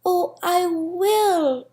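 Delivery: high, slow earnest promise; heartfelt cry. A narrator's reading. The little soul promising the friendly soul, slow heartfelt cry. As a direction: stretch it out, pleading. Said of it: "cried the little soul".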